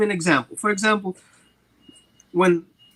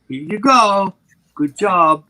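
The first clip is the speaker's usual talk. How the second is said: louder, upbeat dog praise; 7 dB louder, slower than usual. Retold or imitated. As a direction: reward him out loud.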